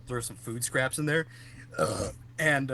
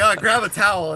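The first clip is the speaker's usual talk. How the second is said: urgent call; 7 semitones higher, 10 dB louder than usual. Retold or imitated. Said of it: "I'm like".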